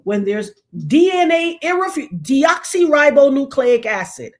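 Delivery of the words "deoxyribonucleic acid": ordinary talk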